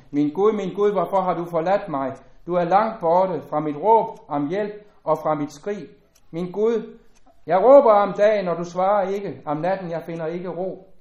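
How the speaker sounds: pleading psalm reading. Acted. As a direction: cry the lament out, building on jeg råber.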